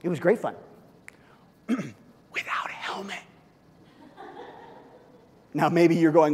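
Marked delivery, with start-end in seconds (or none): 1.7-3.2 conspiratorial whisper; slow hushed whisper; whispered aside. Acted. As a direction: lean in and whisper it like a secret.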